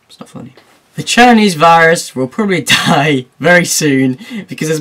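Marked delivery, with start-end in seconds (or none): 0.1-0.5 whisper. Real, not acted. Repeats line, second take hushed.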